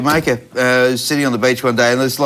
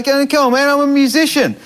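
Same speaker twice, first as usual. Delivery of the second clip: higher, pleading voice, "musician" pushed up high; insistent plea; 9 semitones higher than usual. Retold or imitated. Guitar man pleading with searching cops, higher and insistent. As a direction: beg the cops to believe you, voice raised. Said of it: "They go".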